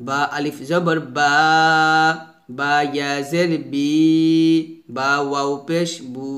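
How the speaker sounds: slow spelling chant ending in stretched বা, বি, বু; measured drill with long vowels on বা, বি, বু; madd spelling drill. Shown as a demonstration. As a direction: spell each out, holding the final vowel of বা, বি, বু. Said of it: "এক লিপ টেনে পড়তে হয়".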